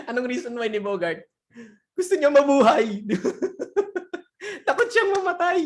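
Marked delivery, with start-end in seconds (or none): 2.0-4.7 high, loud, laughing punchline; real cracking-up delivery. Real, not acted. Laughing at the joke's answer. 4.7-5.7 much higher, helplessly amused voice; real high-pitched giggly delivery; laughing. Real, not acted. Laughing at the joke's answer.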